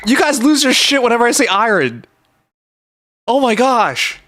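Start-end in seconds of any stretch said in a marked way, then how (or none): 0.1-1.5 high, excited realization; real excitement, voice up high; excited recognition. Real, not acted. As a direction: shout it as it all clicks. Recognizing the iron joke.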